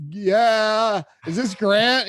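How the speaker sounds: loud, high, excited answer on the phone; freaking out. Retold or imitated. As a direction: blurt it out, thrilled and nervous. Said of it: "I was freaking out".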